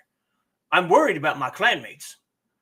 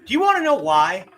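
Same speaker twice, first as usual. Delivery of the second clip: high, loud playful tease; excited teasing; 8 semitones higher, 5 dB louder than usual. Real, not acted. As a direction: tease it with bright energy. Teasing question, then repeated.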